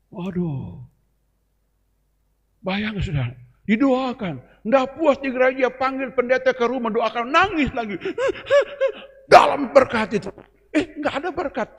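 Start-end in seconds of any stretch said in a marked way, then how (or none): 3.7-11.8 rising exasperated mockery. Real, not acted. Building mockery of crying believer.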